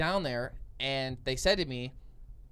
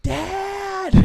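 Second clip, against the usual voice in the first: put-on high, drawn-out kid's scream; screaming for Dad; 4 dB louder than usual. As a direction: scream for help like a scared kid. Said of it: "So I scream, "Dad".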